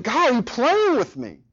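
high, pleading cry to God, rising as it goes; despairing prayer. Retold or imitated. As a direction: beg God in exhausted frustration, half-imitated. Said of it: "I'm tempted to think".

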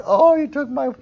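high whiny complaint; mock whining. Abraham as he did not sound, a high mock whine. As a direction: whine it like a petulant child. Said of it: "he wasn't, like, whining".

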